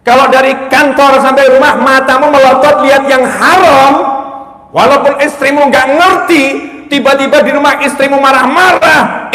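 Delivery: ceramah berapi-api. Acted. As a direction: drive each point home to the congregation. (khotbah lantang berapi-api)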